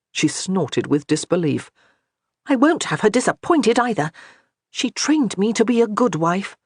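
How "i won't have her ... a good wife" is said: higher, lightly voiced proud wife; insistent wife. A narrator's reading. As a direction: press the point about her training. Johanna insists.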